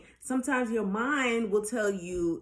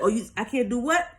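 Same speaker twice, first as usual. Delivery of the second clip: louder, mock-offended challenge; arguing with ego; 3 semitones higher, 5 dB louder than usual. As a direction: throw the challenge back at your ego. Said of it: "I be arguing with my ego".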